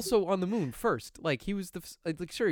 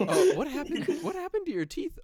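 higher-pitched mock shock; shocked date; 12 semitones higher than usual. Date spots a missing tooth, mock shocked. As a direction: ask in high, startled disbelief.

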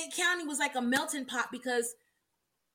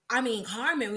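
normal talking voice

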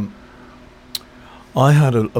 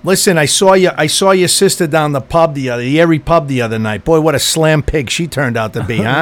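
put-on South Boston accent, slightly raised; Southie accent; 3 semitones higher than usual. Co-host tells of your sister down the pub in a Southie accent. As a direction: gossip like a Southie pub regular. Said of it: "sounded more like a Southie accent".

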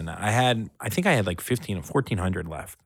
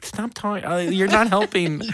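high, flustered protest; retold flustered plea; 10 semitones higher than usual. Begging the stripper's friend to stop, high and flustered.

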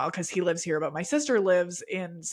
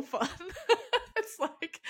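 high giggle breaking into words; laughing; 11 semitones higher, 4 dB quieter, slower than usual. Real, not acted. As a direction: laugh through it, bubbly. Laughing about the game.

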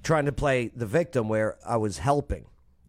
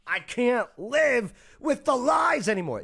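high, drawn-out melodramatic wail; acting the dog owner; 13 semitones higher, 3 dB louder, slower than usual. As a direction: wail it slowly, hammed-up and high. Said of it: "just read it like her".

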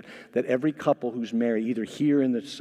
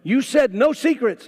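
upset groom; 10 semitones higher, 7 dB louder than usual. Retold or imitated.

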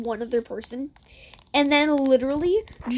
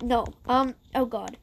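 ordinary talk

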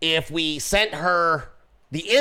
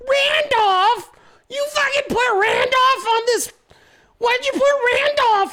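much higher, louder outraged yelling; mock furious shriek; 3 dB louder than usual. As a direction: shriek it as the offended girlfriend. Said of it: "I could hear her go".

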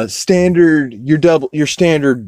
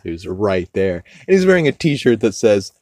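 usual speaking voice

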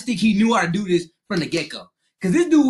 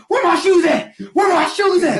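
high, loud frantic asking; frantic where my shoes at; 10 semitones higher, 5 dB louder than usual. Retold or imitated. Barefoot kid after fight, frantic about his shoes. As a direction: yell it like you just lost your shoes.

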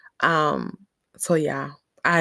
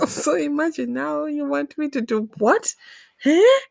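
incredulous; 9 semitones higher than usual. Acted. Daughter asked to handle chickens she fears, incredulous. Acted disbelief at the demand.

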